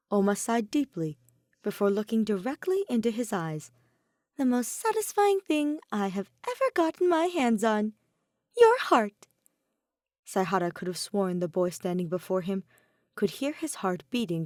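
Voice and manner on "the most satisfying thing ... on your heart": playful reveal. Acted. Oma's playful confession.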